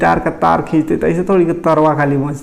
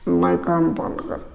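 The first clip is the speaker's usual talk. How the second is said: strained, held throat; 6 dB quieter, slower than usual. Shown as a demonstration. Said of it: "ये कंपन नहीं करने देते".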